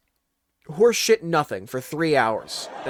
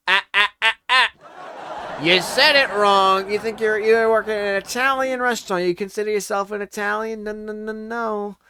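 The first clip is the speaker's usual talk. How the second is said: hammed-up mom correcting the waiter; mock mom scolding; 6 semitones higher, 7 dB louder than usual. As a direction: lecture him like a smug mother. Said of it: "And then she's like".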